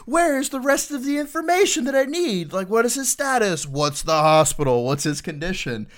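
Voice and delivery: high, loud panicked demands for details; frantic sergeant major. Retold or imitated. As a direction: squeal it out like a boss losing it. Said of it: "And he's like".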